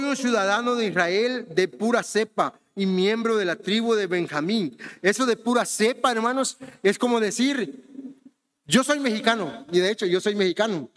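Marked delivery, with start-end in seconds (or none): none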